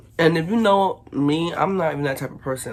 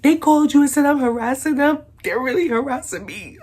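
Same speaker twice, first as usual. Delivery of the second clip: high, loud whiny imitation; mock her teary complaint; whiny neighbor voice; 10 semitones higher, 5 dB louder than usual. Quoting the neighbor crying to police.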